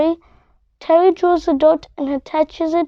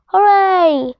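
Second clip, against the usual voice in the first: bright cheer, a little higher and louder; cheerful shout; 3 semitones higher, 3 dB louder than usual. Acted. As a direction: cheer the win out. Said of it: "shouts Doctor Moose".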